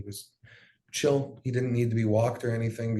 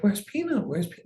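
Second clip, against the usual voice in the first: higher-pitched, imitating a child's confusion; confused little sister; 10 semitones higher than usual. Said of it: "all confused".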